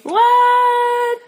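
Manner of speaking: high, loud fake-astonished cry; mock shock. Co-host hears no real book was read, mock shock. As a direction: feign outrage, stretched high and loud.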